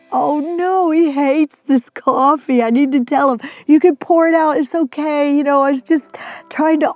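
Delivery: high, anxious rushed inner voice; flustered, worried self-talk; panicked worry. Retold or imitated. Retold panic over chief's tears.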